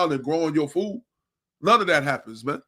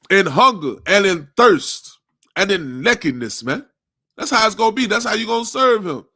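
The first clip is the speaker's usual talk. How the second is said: preaching at full force; 3 semitones higher, 4 dB louder than usual. Real, not acted. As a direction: hammer each word like a street preacher.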